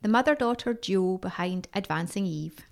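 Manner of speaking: measured, scripted announcer delivery; podcast intro read. Acted. Host welcomes listeners to Asking Eve, scripted intro read.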